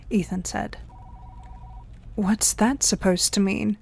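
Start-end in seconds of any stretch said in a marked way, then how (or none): none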